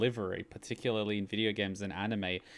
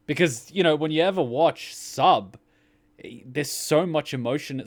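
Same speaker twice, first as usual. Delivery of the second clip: louder and higher gushing; excited; 5 semitones higher, 7 dB louder than usual. Real, not acted. Fan gushing about Japanese emotional acting, excited. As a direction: gush about it. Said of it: "excited about Japanese acting".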